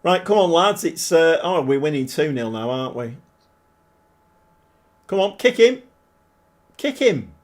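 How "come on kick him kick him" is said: higher, louder call of "kick him"; urging the players on. Real, not acted. Streamer urging his team during the match, higher and louder. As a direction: egg them on from the sofa.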